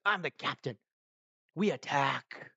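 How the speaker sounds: loud, commanding order; brash captain calling the attack; captain shouting. Retold or imitated. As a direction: bark it out like a gung-ho captain. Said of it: "shouting everything".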